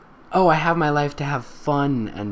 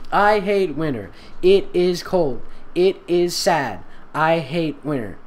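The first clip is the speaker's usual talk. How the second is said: higher, slow sing-song mock; mocking childish whine; 6 semitones higher than usual. Reader mocking the fanfic's childish lines in a slow whine. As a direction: recite it like a bored second grader.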